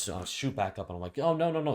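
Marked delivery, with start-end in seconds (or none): 1.2-1.7 much higher and louder flustered denial; jolted awake, hurried protest; startled no no no. Retold or imitated. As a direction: caught off guard, brush it off fast. Snapping awake to waitress.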